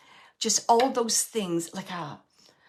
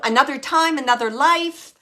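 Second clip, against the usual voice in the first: light joking aside, louder; kinda joking; 5 semitones higher, 9 dB louder than usual. Retold or imitated. Medium passing on a spirit's joke to the sitter, playful and louder. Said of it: "kinda joking".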